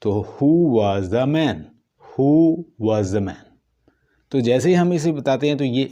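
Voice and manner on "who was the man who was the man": WHO stressed, then the tune falls, said slowly; stress on who, falling. Shown as a demonstration. Teacher demonstrating a falling question to students. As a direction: hit Who and let the pitch drop to man. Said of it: "falling है".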